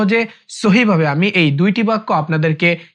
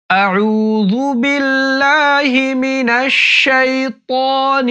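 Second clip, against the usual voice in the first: slow, melodic tajweed chant, higher than speech; slow Quran recitation; 5 semitones higher, much slower than usual. Shown as a demonstration. Teacher chanting the full phrase for students to follow. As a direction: recite it slowly and tunefully.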